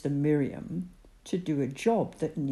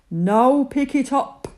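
loud, higher firm command; God commanding; 8 semitones higher, 9 dB louder than usual. Acted. God ordering Moses to pick up the snake. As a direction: command it firmly. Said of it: "God commanded".

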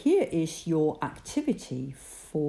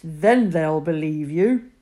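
laughing God; 8 dB louder than usual. Acted. God speaks to Moses.

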